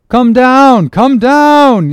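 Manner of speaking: very high, loud repeated yell; desperate warning shout aloft; frantic yell. Acted. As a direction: scream up at him to get down. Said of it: "yelled the seaman to Dago".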